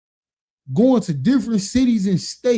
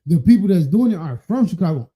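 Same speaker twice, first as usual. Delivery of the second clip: normal talking voice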